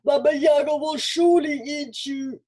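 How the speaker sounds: high, loud warning wail; spooky house voice. A narrator's reading. The house warns Olga off, wailing. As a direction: moan the warning like a haunted house. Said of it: "the house moaned".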